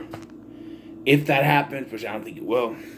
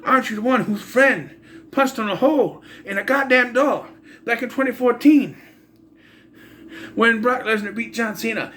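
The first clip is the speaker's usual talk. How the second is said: higher-pitched, indignant mock challenge; imitated viewer; 7 semitones higher than usual. Viewer challenging the host about his old outburst, imitated indignation. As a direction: question the host in an accusing, raised pitch. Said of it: "you're saying".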